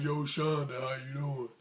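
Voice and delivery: a hint of a put-on deep voice; lightly voiced kid trying to sound deep; mock deep voice. Boy trying to impress Shonda, lightly mocked deep voice. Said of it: "Dude was trying to do the deep voice".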